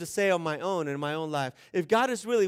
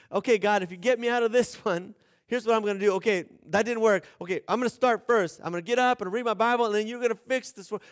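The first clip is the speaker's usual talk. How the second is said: bargaining with God; 4 semitones higher, 5 dB louder, faster than usual. Retold or imitated. Bargaining prayer acted out.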